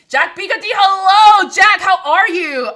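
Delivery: high, very loud shout-out; shouted greeting. Real, not acted. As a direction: yell hello across the room.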